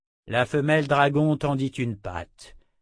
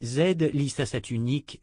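ordinary delivery, nothing marked

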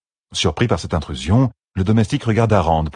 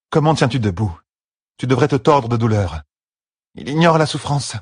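higher, exasperated woman's voice; worried healer; 7 semitones higher than usual. A narrator's reading. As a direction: scold him for ignoring the pain. Nineveh voiced, worried and scolding.

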